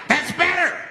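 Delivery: high acted unhinged voice; high-pitched approval. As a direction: pipe it up high, as the crazed man. The man answers the quieted crowd.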